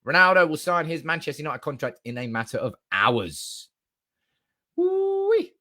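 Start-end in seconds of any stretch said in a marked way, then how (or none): 4.7-5.5 high, drawn-out excited whoop. Real, not acted. Fan hearing signing is hours away, gleeful whoop. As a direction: let out a long high woo-wee.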